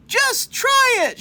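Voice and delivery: high loud defiant shout. Acted. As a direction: javelina shouts back at coyote, high and defiant. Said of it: "shouted the third little javelina".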